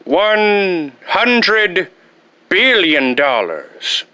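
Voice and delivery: drawn-out villain demand; slow grand demand. Acted. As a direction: savour it slowly. Repeats ransom demand grandly.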